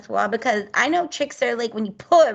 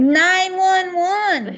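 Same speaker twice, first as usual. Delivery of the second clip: excited answer; 7 semitones higher, 5 dB louder, much slower than usual. Real, not acted. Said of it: "we know what emergencies are, right?".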